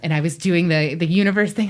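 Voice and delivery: ordinary talk, nothing marked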